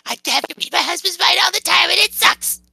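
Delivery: very high character voice, fed up; high-pitched exasperated game character; put-on character complaint.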